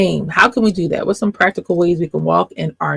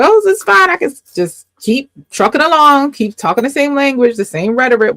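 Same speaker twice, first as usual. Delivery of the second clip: much higher, breezy careless tone; mock carefree; 6 semitones higher, faster than usual. Mocking people who ignore their triggers, breezy. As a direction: play the oblivious one who shrugs it off. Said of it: "Not for us to just be like".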